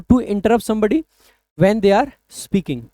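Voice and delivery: stretched definition for notes; slow, clear teacher dictation. Shown as a demonstration. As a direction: dictate the meaning slowly. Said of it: "gives the definition".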